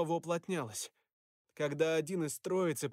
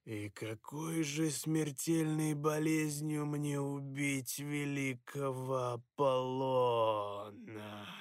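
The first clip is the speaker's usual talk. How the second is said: gurgle it out menacingly; gurgling voice; 2 semitones lower, much slower than usual. Acted. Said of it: "пробулькал дух".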